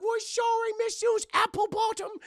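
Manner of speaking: very high, loud mock British girls; squeaky sorry. Girls apologising to the teacher, squeaky mock British. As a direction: squeak an apology in a put-on English voice.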